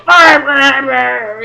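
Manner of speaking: loud groaning cries, fading; lying hurt at the bottom of the stairs, groaning; pained groan. Retold or imitated. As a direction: groan in pain after hitting the wall. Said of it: "I'm just like".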